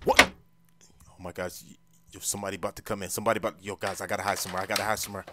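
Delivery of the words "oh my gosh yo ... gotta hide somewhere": low-volume, urgent aside; hushed alarm. Acted. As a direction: keep it down, someone is coming. Hiding as someone comes in.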